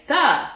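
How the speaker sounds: word THE said alone, high and loud; student counting words aloud. Shown as a demonstration. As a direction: call out THE as a separate, punched word. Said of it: "student's able to say".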